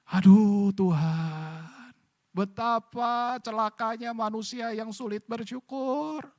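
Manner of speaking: sad lament. Acted. Preacher laments ungrateful man. (ratapan pelan dan sedih)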